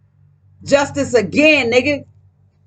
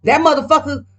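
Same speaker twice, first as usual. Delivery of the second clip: normal talking voice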